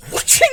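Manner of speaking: loud whoosh of a blade; whoosh sound effect. Acted. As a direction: voice the swing of a sword. Sword swing sound effect.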